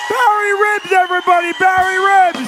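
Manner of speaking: very high, loud announcer shout; hype shout. Real, not acted. Host to the audience, loud hype shout. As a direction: whip the crowd into applause.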